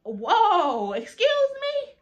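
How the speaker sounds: high-pitched, drawn-out disbelief; shocked indignation. Retold or imitated. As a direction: stretch it out high, utterly taken aback. Indignant reaction to being ordered back.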